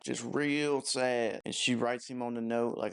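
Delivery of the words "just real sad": drawn-out sad. Real, not acted. Stretched-out sad emphasis.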